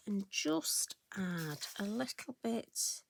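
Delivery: slow whispered aside; slow down and breathe the words. Real, not acted. Crafter aside while stamping.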